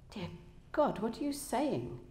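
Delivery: understated alarm in a read-aloud voice; fearful. A narrator's reading. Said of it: "could no longer hide his fear".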